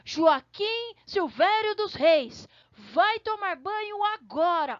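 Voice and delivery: the mom who means business, every name stretched; mother using full name. Retold or imitated. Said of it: "a mãe fala o nome completo do filho". (mãe brava chamando o filho pelo nome completo, mais aguda e devagar)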